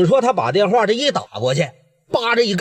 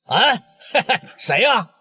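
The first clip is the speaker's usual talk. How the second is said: higher, giggling cheerful pickup; tipsy giggle; 6 semitones higher than usual. Acted. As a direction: laugh into the phone, a bit tipsy. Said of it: "嘚儿喝的啊".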